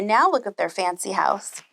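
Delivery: plain reading voice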